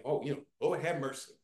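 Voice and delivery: low, soft, quick throwaway phrase; just casual talk. Retold or imitated. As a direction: toss it off quietly, without meaning it. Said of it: "we just kinda talk".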